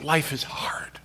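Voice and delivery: life is hard, voiceless; whispered groan. Acted. Son groaning, whispered. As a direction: barely breathe it. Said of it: "groaned aloud".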